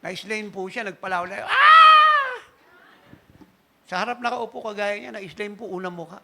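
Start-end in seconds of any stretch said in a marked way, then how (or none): 1.5-2.4 a sudden, much higher shouted "Ah!"; loud cry. Retold or imitated. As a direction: cry out as if overcome by the spirit. Pastor bursting out in the spirit. (biglang malakas na sigaw)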